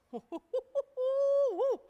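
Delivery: sudden high loud laugh; a sharp, disbelieving laugh breaking out; burst of laughter. Real, not acted. Laughs at shocking statistic.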